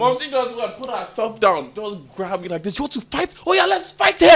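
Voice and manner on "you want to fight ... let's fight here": very high-pitched goading; mock fighting challenge. Mom squaring up to child, imitated fighting challenge. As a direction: goad them into a fight, rising high and louder.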